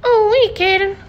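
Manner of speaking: put-on high, loud stammering teen voice; squeaky nervous Morty; Morty impression. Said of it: "Morty from Rick and Morty".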